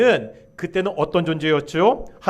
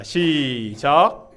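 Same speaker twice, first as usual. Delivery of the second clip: long drawn-out cue, rising at the end; calling the start; 3 semitones lower, much slower than usual. Real, not acted. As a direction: stretch it out to cue the congregation. 회중에게 읽기 시작 신호. (길게 늘여 읽기 시작을 알리는 구령)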